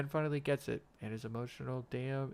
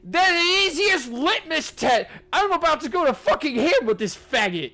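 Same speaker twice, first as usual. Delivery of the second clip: much higher, much louder yelling; furious screaming rant; 8 semitones higher, 14 dB louder than usual. Real, not acted. Yelling insults at Ben, furious screaming.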